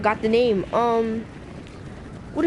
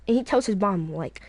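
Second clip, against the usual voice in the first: plain reading voice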